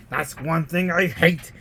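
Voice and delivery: usual speaking voice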